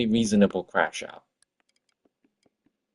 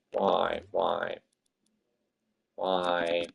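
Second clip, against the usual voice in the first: despairing whys; 3 semitones lower, 6 dB quieter, much slower than usual. Real, not acted.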